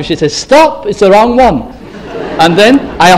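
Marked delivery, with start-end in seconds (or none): 0.3-1.6 high urgent call of stop; wife shouting up the steps after him, high and urgent. Retold or imitated. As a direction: call him back from the wrong plane. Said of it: "my wife called after".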